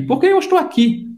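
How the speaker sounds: high, fast, teasing self-important tone; mock smug boast. Teacher mimics the singer bragging, mock smug. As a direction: playfully show off like a star. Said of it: "Ela se acha".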